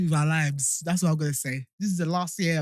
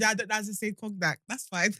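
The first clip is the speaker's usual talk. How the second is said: normal talking voice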